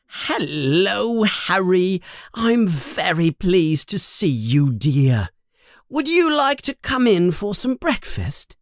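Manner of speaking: high, sugary welcome to a guest; sudden sweetness. Acted. Mother turning kindly to Harry, high and sweet. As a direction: switch to honey for the visitor. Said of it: "she stops yelling and says".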